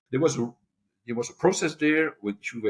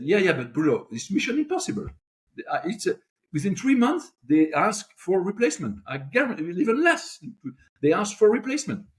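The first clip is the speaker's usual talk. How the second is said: warning boss; 8 semitones higher than usual. Acted. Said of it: "And he said".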